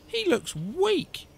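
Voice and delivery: high, slow, drawn-out complaint; fussy mother's grumble; grumbling. A narrator's reading. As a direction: stretch it out in disapproval. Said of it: "she grumbled".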